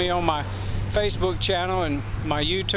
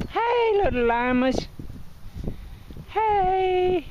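much higher, sing-song voice for animals; pet talk; 13 semitones higher, much slower than usual. Real, not acted. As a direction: coo at the llamas like pets. Calling to the llamas.

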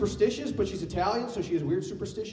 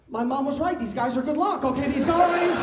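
gleeful; 7 semitones higher, 4 dB louder than usual. Retold or imitated. Inner thought during sex.

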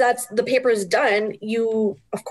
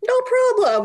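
breezy no problem; 13 semitones higher than usual. Acted. Brushing off pandemic hardships.

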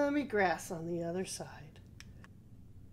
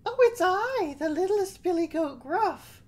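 very high, loud little goat; squeaky nervous baby goat; 11 semitones higher, 8 dB louder than usual. Acted. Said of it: "said the baby Billy Goat".

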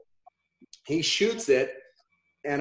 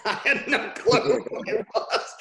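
high, breaking into real laughter; laughing confession; 6 semitones higher than usual. Real, not acted. Admitting the bluff to listeners, laughing. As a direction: laugh through the admission.

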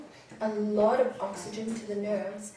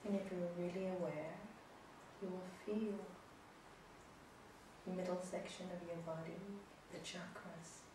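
soft spa whisper; 3 semitones lower, 20 dB quieter than usual. Acted. Guided breathing final rounds.

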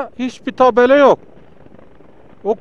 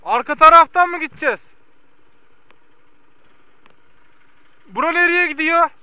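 calling out; 8 semitones higher than usual. Real, not acted. Shouting questions to villagers.

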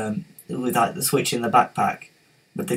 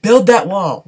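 loud, higher mock Trump shout; Trump impression; 5 semitones higher, 9 dB louder, slower than usual. Teen's terrible Trump impression for the chat, loud mock shout. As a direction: belt it out like a bad Donald Trump. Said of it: "And that was terrible".

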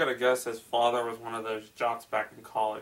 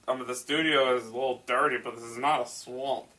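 ordinary talk

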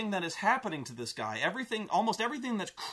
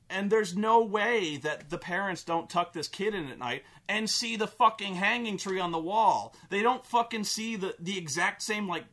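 ordinary talk, nothing marked

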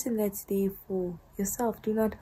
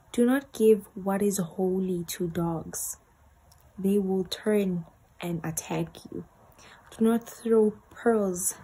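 slow, louder, solemn reading voice; scripture reading; 4 dB louder than usual. Acted. Vlogger reading her diary scripture to viewers, slow and solemn. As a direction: read it out like a sermon from the pulpit.

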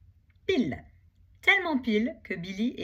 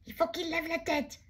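much higher, urgent yell; shout; 10 semitones higher than usual. Acted. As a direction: yell the plan to your friend. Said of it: "crie Billy". (crié, aigu et pressant)

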